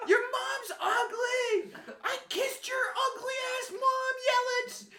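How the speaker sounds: put-on very high, loud drunk-fan screech; screaming heckle. Drunk fan heckling an outfielder from the railing, screaming. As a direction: scream taunts across the ballpark. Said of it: "I'm just screaming at him".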